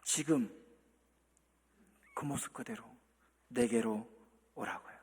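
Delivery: the Lord softly inviting; tender invitation. Pretend. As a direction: draw them in gently. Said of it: "주님은 말씀하십니다".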